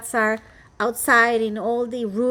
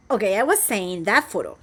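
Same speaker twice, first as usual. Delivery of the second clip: normal talking voice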